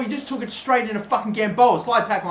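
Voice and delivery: usual speaking voice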